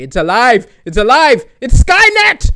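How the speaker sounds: loud, high panicked yell; mock horror shout. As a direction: scream that the vacuum is alive. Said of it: "I'll say".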